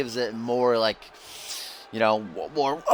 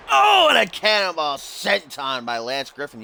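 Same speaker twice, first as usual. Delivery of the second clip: louder, raised commentator shout; excited call; 7 semitones higher, 4 dB louder than usual. Real, not acted. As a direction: call the cannonball with a burst. Calling a big move.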